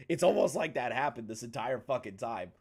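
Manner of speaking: usual speaking voice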